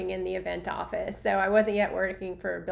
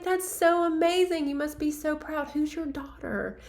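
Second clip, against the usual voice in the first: higher-pitched eager congratulations; excited gushing; 7 semitones higher than usual. Retold or imitated. Fan congratulates the proud mom in the restroom, higher excited voice. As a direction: gush and ask eagerly. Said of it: "I'm like".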